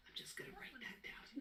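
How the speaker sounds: whispered aside; whispering. Pretend. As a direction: whisper it to yourself. Observer notes aside.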